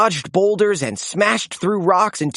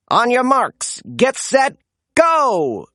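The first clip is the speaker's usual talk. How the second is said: raised, higher race start call; announcer's cry; 6 semitones higher than usual. A narrator's reading. Announcer calling the race start, raised and high. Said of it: "cried the announcer".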